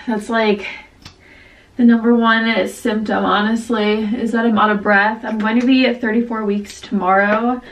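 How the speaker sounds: ordinary talk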